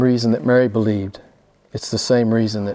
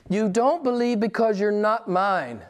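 slow, higher-pitched blunt verdict; blunt Jesus; 7 semitones higher, 3 dB quieter, slower than usual. Retold or imitated. Jesus tells the religious guys off, blunt. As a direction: state it slowly, voice raised in pitch. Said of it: "when Jesus talks like this".

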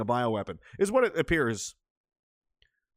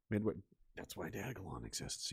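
ordinary delivery

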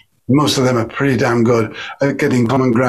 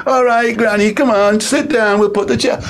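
talking to granny; 7 semitones higher than usual. Shown as a demonstration. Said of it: "If you're talking to an old person".

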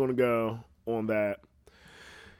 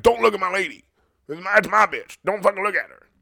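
put-on jealous boyfriend; 7 semitones higher, 6 dB louder, faster than usual. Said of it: "Be like".

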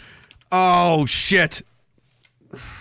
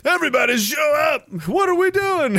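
hype shout; 8 semitones higher than usual. Pretend. Hyping fake event.

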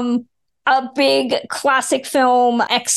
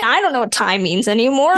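ordinary talk

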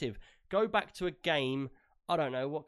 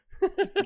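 high burst of laughter; 6 dB louder than usual. Real, not acted. Laughs at graphics card joke.

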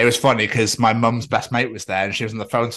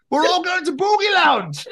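high mock yell through laughter; shouting across streets. Man announcing the club to the street, shouting. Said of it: "shouting at people across the streets".

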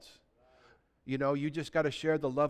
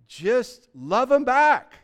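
louder, slow and emphatic; urgent preaching; 5 semitones higher, 8 dB louder, much slower than usual. Real, not acted. Pastor urges his congregation, slow and forceful. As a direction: press it home, drawn out.

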